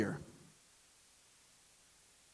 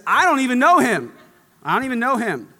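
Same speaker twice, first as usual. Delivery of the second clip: higher, louder, frantic insistence; panicked denial; 6 semitones higher, 5 dB louder, faster than usual. Acted. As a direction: deny it in a panic.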